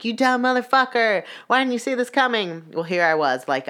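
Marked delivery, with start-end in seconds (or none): 0.0-2.6 high-pitched berating of a movie character; exasperated heckle, acted out; mock scolding. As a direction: scold the screen in disbelief, voice pushed up. Imitated viewer scolding a movie character.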